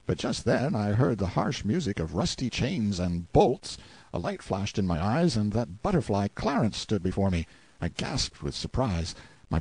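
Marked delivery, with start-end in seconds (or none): none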